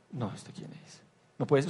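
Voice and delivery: a hushed whisper of disbelief; stunned whisper. Retold or imitated. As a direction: breathe it out, shaken.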